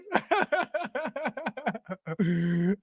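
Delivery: long laugh dying away; helpless, softening laughter. Real, not acted. Streamer tickled by chat comment, laughing.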